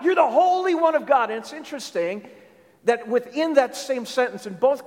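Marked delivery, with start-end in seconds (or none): 0.0-1.3 high, loud demonic outcry; calling out. Acted. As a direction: call it out loudly, voice pitched up. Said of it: "they're calling out".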